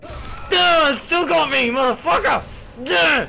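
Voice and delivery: high, loud frustrated yells; angry shouting. Real, not acted. Gamer furious that the monster still got him. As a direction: yell in annoyance at dying.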